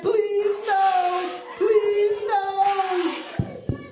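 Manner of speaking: high, drawn-out mock plea; acted begging. Officials begging the king again, dramatic pleading. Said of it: "continue to beg".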